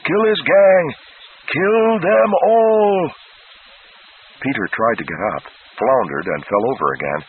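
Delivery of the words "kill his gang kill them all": high, loud raging screech; hellish shriek. A narrator's reading. Flagg from the Needle screeching at the guards. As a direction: scream the order like a madman. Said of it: "Flagg shrieked hellishly".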